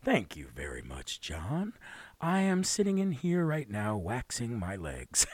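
deep, quiet parody radio guest. Pretend. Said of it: "[name], take it away".

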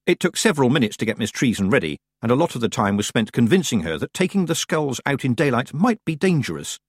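usual speaking voice

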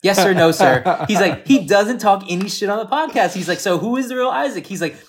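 high, loud excited retelling; quoting Joe. Retold or imitated. Retelling what Joe said about Isaac, high and loud. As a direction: mimic a worked-up friend's rant. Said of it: "He was like".

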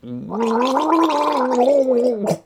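gurgling a mouthful of water; while gargling. Real, not acted. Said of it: "watch me gargle water".

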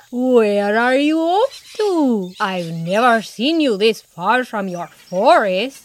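slow, jolly character voice; jolly porcupine. A narrator's reading. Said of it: "a jolly-sounding voice".

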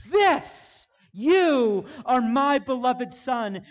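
high, loud ringing cry, then slow; heavenly voice calling out. Retold or imitated. Preacher voicing God's call from heaven, ringing out. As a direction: proclaim it like a voice from heaven. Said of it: "here comes a voice from heaven screaming out".

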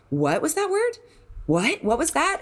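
high, puzzled voice of a listener hearing a new word; a bewildered playgoer asking what that word was; confused audience member. Retold or imitated. Audience member to neighbour, high and puzzled.

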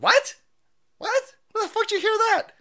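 very high, loud, fast shocked cry; shocked disbelief. Retold or imitated. Reacting to chat's divorce news, very high loud shocked squeal. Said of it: "And I was like".

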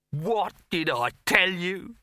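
slow, high-pitched triumphant cry; proclaiming. A narrator's reading. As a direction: proclaim it to the sky in general. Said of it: "he said to the sky in general".

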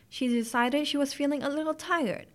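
usual speaking voice

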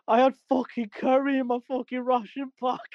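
high mock whine from his mate; imitating a friend caught short; mate's voice. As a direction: squeak it out as the desperate mate. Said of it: "And he's like".

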